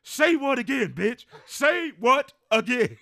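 very high, loud, slowing into a shout; retold furious yell. Friend screaming at his girlfriend, hammed-up high shout. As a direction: scream it like a movie tough guy daring her. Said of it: "She's like".

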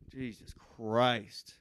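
normal talking voice

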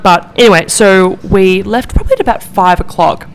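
ordinary talk

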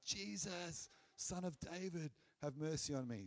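mock calling out at talking volume; crying out. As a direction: retell the plea as a contained cry. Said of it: "this person that's crying out".

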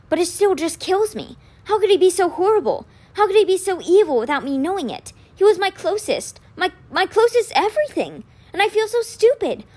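high-pitched, anguished lament; hurt, distraught. A narrator's reading. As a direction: pour out disbelief at a betrayal. Betrayed twin pours out hurt.

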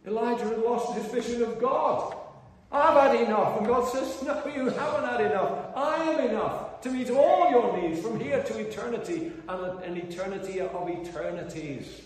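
high, very loud impassioned preaching; soaring pulpit cry, easing slightly at the end; preaching at full force. Real, not acted. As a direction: thunder it out to the congregation.

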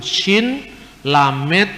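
normal talking voice

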